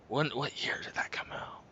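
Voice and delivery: ordinary delivery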